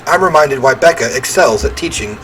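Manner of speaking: ordinary talk, nothing marked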